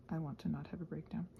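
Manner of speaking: usual speaking voice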